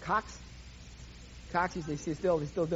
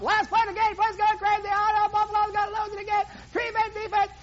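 higher, excited announcer call; play-by-play; 10 semitones higher, 4 dB louder than usual. Acted. Preacher narrating Bills' final play, excited announcer. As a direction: call the last play live.

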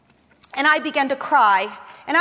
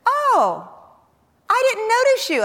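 put-on surprised boyfriend; 7 semitones higher than usual. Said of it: "And he goes".

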